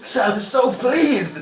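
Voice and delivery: high, loud, laughing through the words; delighted deity chuckling out a blessing; laughing god. Acted. Shiva laughs while granting boon.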